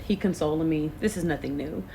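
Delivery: normal talking voice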